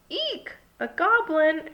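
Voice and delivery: high-pitched storybook shriek. A narrator's reading. Everyone fears a goblin outside, shrieking. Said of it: "everyone shrieked".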